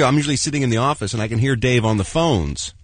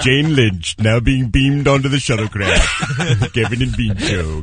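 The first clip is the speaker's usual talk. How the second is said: slow, ceremonious starship announcement; measured mock officer voice, stretched out; Lieutenant Sulu; 2 semitones lower, slower than usual. Cohost as officer beaming the guest aboard, slow and official.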